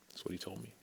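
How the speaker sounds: soft unvoiced whisper; a quiet whispered aside. Real, not acted. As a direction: drop to a private whisper.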